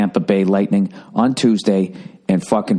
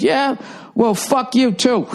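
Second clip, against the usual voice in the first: much higher snippy retort; mock rude caller; 13 semitones higher, slower than usual. Wrong-number caller hanging up, high mock snippy retort. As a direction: sneer it as you hang up. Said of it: "And he goes".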